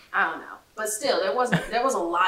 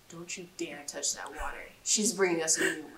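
hushed, much softer warning; whispered warning; 4 semitones lower, 7 dB quieter than usual. Retold or imitated. Whispering to her friend not to touch the water.

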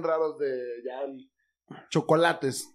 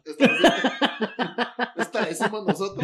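high, laughing voice; laughing through each line; 7 semitones higher, 6 dB louder than usual. Real, not acted.

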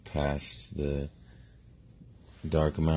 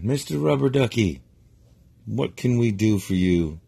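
loud, high call down from the castle; 6 semitones higher, 6 dB louder than usual. Acted. Princess calls down from the entryway, loud. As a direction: shout down to the duck below. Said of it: "She yells down".

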